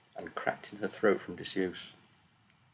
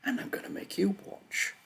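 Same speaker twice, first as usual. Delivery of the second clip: threatening voice; 12 semitones higher than usual. A narrator's reading. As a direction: promise suffering in a sinister whisper. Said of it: "the voice threatened".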